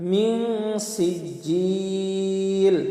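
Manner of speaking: "সিজিল" chanted with a very long last vowel; long madd. Shown as a demonstration. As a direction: hum "মিন", then hold "জিল" three counts. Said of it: "জিলে তিন আলিফ টান". ("সিজিল"-এ তিন আলিফ টান)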